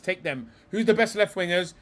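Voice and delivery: ordinary delivery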